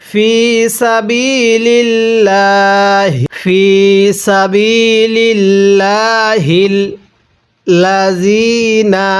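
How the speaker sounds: slow, drawn-out Quranic chant; measured tajweed recitation. Shown as a demonstration. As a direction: recite each word slowly and melodically. Teacher reciting Quran slowly.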